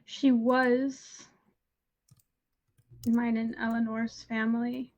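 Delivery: slow, halting, monotone reply; dissociated character. Acted. Character explaining a lost relative when asked, halting monotone. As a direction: answer haltingly, with long empty gaps. Plays shaken character answering questions.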